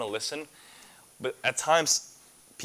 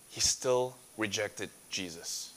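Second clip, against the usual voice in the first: ordinary talk, nothing marked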